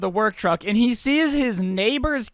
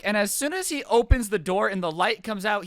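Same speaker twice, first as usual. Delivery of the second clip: ordinary talk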